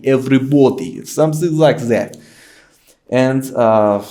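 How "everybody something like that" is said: loud Russian-accented pronunciation; fake Russian accent. Shown as a demonstration. Guest gives one more example, Russian accent. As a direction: pronounce it heavily, Russian-style. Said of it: "pronounce every single word in the shittiest".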